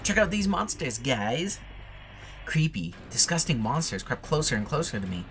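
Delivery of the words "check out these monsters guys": excited. Real, not acted. Excited chat aside.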